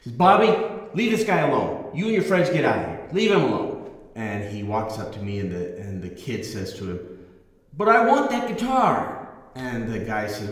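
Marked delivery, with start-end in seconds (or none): 0.2-3.9 loud, higher retold scolding of a son; father scolding. Father telling his son to leave the guitarist alone, loud and stern. 7.8-9.3 put-on much higher, louder voice of a demanding kid; spoiled teen. Teen son insisting to his father, high and demanding.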